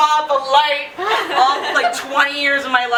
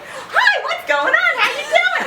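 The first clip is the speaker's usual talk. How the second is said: much higher, chirpy, overly friendly greeting; mock-cheerful doctor voice; bubbly doctor; slower than usual. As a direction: play the upbeat doctor, all sunshine. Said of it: "the antithesis of the nurse".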